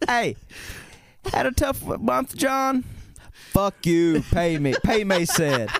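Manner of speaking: high-pitched loan shark pressing a debtor. Acted. Lender leaning on John for Mason's money, mock threatening.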